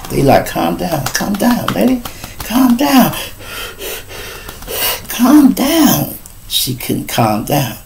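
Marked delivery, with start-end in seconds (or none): 0.5-6.2 soothing medics. Retold or imitated. Medics soothing panicking woman.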